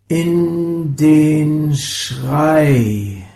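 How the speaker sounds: slowly building to a scream. Acted. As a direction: stretch each word long and loud. Sound poem, drawn-out line.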